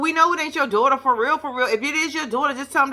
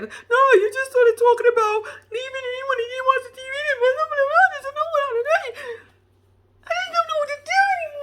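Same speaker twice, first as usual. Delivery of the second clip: high-pitched whiny fake sobbing; hammed-up tearful squeal, words blurring; mock crying; faster than usual. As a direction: wail it like an exaggerated crybaby. Mimics the wife's crying fit.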